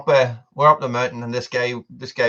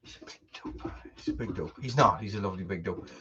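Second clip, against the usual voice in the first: fond soft aside; 6 semitones lower, 14 dB quieter, faster than usual. Real, not acted. Fond words about the dog.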